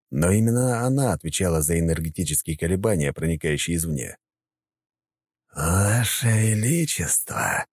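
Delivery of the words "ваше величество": slow, drawn-out mocking greeting; sly villain. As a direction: savour the title slyly. Possessed puppet greets emperor.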